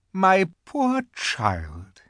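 high, very slow, drawn-out coo; cooing false sympathy. A narrator's reading. The Queen changes her mind, cooing. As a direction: turn sweetly tender, stretching the words. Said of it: "in a quite different voice".

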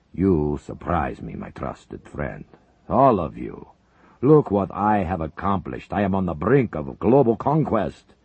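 slow, heavy voice of a menacing general secretary; Soviet leader. Acted. Zelinkov to his uneasy comrades, slow heavy menace. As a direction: savor each word like a man in power.

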